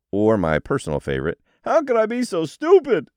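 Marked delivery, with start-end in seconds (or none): 1.6-3.1 much higher, loud whiny voice; weird negative voice. Pretend. Someone asking themselves why they always fail, whining. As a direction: moan it in that silly put-on voice.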